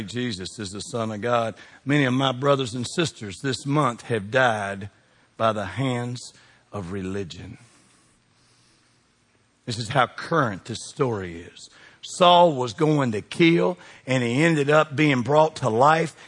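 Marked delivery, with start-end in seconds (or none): 0.0-11.7 much softer and lower, solemn; hushed and grave. Acted. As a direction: drop your voice for the dead. Solemn talk of martyrs.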